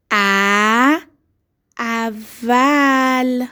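ا اول held very long and slow; stretched dictation. Shown as a demonstration. Teacher dictating to young pupils, stretching اول very slowly. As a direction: draw out اول sound by sound. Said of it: "صدا کشی یادت نره".

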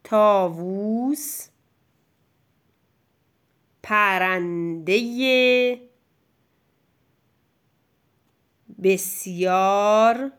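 slow dictation, each word separated by long pauses; clear, measured dictation voice; said slowly and distinctly. Shown as a demonstration. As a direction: dictate word by word so the child can write.

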